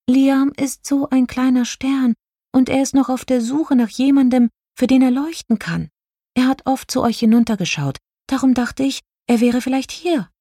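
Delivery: high-pitched, earnest fairy voice; little star fairy explaining. A narrator's reading. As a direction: explain yourself in a small high voice.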